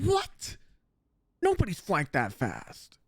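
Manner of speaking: high squeak then stunned mutter; a baffled player who can't believe it; shocked platinum player. Retold or imitated. Platinum player caught by the flank, squeaking in disbelief. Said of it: "He would have been like".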